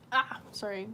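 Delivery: usual speaking voice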